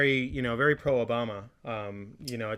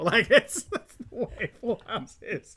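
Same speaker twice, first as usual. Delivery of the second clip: laughing; 9 semitones higher, 4 dB louder, slower than usual. Real, not acted. Still laughing.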